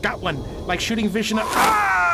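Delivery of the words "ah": a sudden high yelp of pain; sharp cry. Real, not acted. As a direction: cry out as you get hit. Cry out in firefight.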